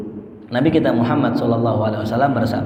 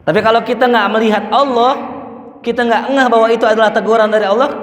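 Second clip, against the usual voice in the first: fervent preaching; 10 semitones higher, 5 dB louder, faster than usual. Real, not acted. Preacher rising to a fervent peak.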